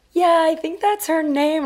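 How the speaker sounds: slow, high-pitched, unsure squeak; scared high voice. Retold or imitated. Bluffing to the stranger at the call box, scared and high. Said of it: "your voice goes up, like, nine octaves".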